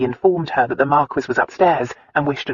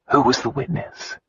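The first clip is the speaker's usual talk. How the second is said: plain reading voice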